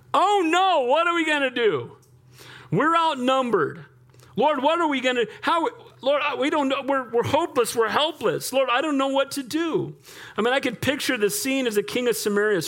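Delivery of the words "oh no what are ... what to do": high, fretful panicked wail; preacher voicing the frightened servant, panicked; panicking servant. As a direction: plead helplessly like a scared servant.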